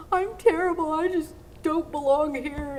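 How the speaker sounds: high, loud despairing inner cry; self-pitying wail. Retold or imitated. Skater wailing at herself backstage, high and despairing. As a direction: wail it like a crushed young skater. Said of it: "and I thought".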